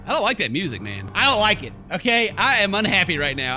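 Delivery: ordinary delivery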